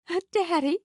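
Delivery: high, delighted question. A narrator's reading. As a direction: repeat it with thrilled disbelief. Nell delighted.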